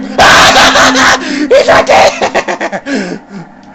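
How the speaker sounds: high, loud laughing yell; panicked laughing scream; laughing shout. Real, not acted. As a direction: shriek it through laughter.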